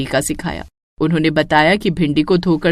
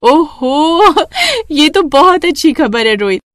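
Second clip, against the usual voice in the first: higher, delighted exclamation; 7 semitones higher, slower than usual. A narrator's reading. Mother delighted by her son's good news. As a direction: burst out in joy.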